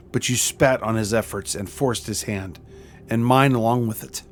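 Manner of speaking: normal talking voice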